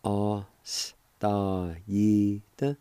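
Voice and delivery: Ostaída stretched out very slowly, each syllable drawn long; very slow pronunciation. Shown as a demonstration. Teacher modelling a word for students, very slowly drawn out. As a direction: draw out Ostaída so learners can copy it. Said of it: "It's pronounced like this".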